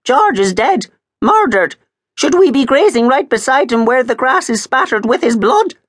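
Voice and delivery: high, loud and fast protest; agitated objection. A narrator's reading. Maud protests to Miss Maple, high and agitated. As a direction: object in a rush, alarmed. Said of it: "objected Maud".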